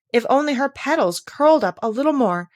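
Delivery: high and loud, looking down; critical. A narrator's reading. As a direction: sniff at her petals. Tiger lily criticizing.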